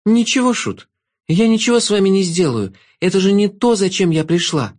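put-on a brave girl standing her ground; young girl Alex. A narrator's reading.

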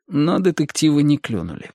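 plain reading voice

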